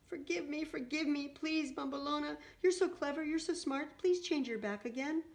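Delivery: high-pitched weepy begging; tearful pleading. A narrator's reading. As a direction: beg desperately, on the edge of tears. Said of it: "wept Antonia".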